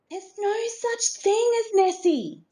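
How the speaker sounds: high mocking big-sister voice; teasing sister. A narrator's reading. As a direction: taunt him, tongue out. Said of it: "said Sarah, sticking her tongue out".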